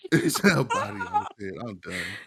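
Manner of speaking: words breaking up with real laughter; laughing. Real, not acted. Cracking up at a friend's mishearing, real laughter. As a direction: laugh through it, can't hold it in.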